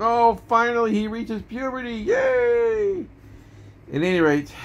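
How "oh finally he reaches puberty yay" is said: high-pitched mock cheer, rising to a squeal; delighted mother. Mother cheering her son finally reaching puberty, mock-delighted squeal. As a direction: play an overjoyed mom, far above your own voice. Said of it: "His mother's like".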